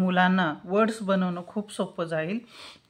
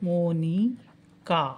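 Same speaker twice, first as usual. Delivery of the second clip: slow, each syllable of Monika drawn out; slow spoken name; 4 dB quieter, much slower than usual. Shown as a demonstration. Teacher writing the spelling, reading Monika slowly. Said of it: "मोनिकाची spelling".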